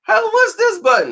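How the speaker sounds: high falsetto, puzzled girl imitation; mimicking a girl. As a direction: put on a girlish squeak to ask. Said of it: "She was".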